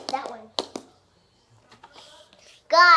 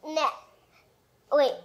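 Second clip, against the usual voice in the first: ordinary delivery, nothing marked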